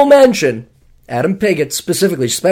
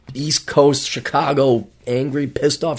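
usual speaking voice

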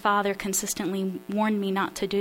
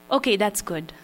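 put-on Polish accent. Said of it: "attempt my father's Polish accent".

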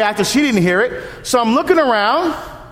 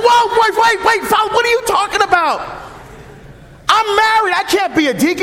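startled protest; 10 semitones higher than usual. Retold or imitated. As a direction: throw up your hands, stunned. Stunned reply to priest's suggestion.

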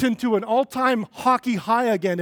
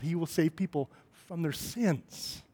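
"sins" set apart at the end, low and soft; stress on SINS; 3 semitones lower, 7 dB quieter than usual. Shown as a demonstration. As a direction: save the weight for "sins". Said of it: "put some different emphasis".

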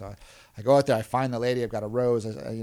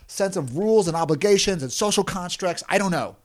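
higher and louder, fretful worrying; anxious; 8 semitones higher, 4 dB louder than usual. Retold or imitated. Trainer worries how Koreans will respond, anxious. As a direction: play his nervous rant about rules. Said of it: "'cause he was like".

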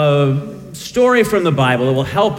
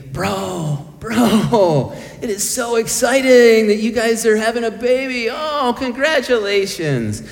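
a lightly voiced, gushing mentor, higher; bubbly, over-the-top well-wishing, pitched up; excited congratulations; 7 semitones higher than usual. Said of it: "He said to me".

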